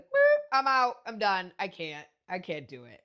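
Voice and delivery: sharp high yelp fading to plain; loud panicked exit that calms down; startled bailing out. Retold or imitated. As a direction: jump back from it, loud then trailing off. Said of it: "he was like".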